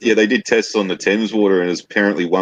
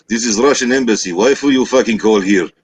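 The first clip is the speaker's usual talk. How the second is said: indignant fake Russian accent; 3 semitones higher than usual. Shown as a demonstration. Fake embassy man warns off a telemarketer, mock Russian accent. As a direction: scold in a put-on Russian accent. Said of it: "sort of like a Russian sounding kind of accent".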